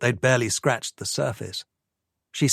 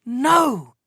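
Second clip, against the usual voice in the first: sharp high shouted no; 13 semitones higher, 4 dB louder than usual. A narrator's reading. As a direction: cut him off with a loud high shout. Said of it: "she shouted".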